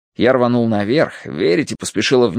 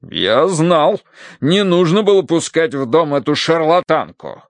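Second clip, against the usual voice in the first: outraged colonel; 6 semitones higher than usual. A narrator's reading. Colonel blames the charlatan for the theft, outraged. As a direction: burst out in vindicated outrage. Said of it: "Полковник от потрясения даже фразы".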